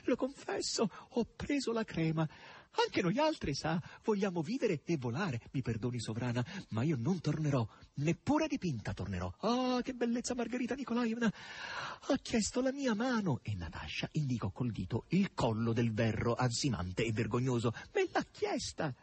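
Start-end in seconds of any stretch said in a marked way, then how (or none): 0.0-13.4 high, breathless gleeful chatter; giddy high chatter; gushing excitement. A narrator's reading. Natasha gushing in flight. 17.9-18.9 very high, slow triumphant squeal; gleeful crow. A narrator's reading. Natasha crowing about proposal.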